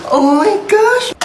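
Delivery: high, drawn-out amazement; gasp, then stretch it out high; delighted gasp. Acted. Child seeing mom's surprise cheesecake, delighted gasp.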